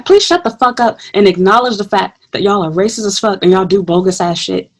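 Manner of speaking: ordinary talk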